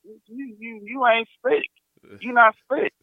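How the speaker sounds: high, stammered playful accusation; knowing call-out. Retold or imitated. Client catching the masseuse peeking, knowing call-out.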